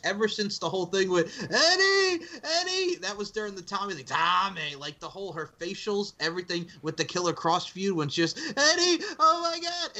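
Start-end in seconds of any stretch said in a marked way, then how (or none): 1.5-2.9 high-pitched mocking impression of bad acting; Alicia. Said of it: "she sucks as an actress". 4.0-4.8 mock Bostonian voice, raised pitch; hammed-up Boston whine; Alicia. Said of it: "that voice, that Bostonian voice". 8.4-10.0 very high mocking Bostonian voice; Alicia. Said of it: "that voice, that Bostonian voice".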